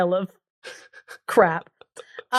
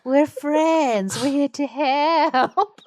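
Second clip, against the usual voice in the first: high, drawn-out silly voice; goofy animated creature, stretching the last word; cartoon dung pile; 7 semitones higher, much slower than usual. Pile of dung comforting crying Pheasant, silly cartoon voice. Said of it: "animated pile of dung being like".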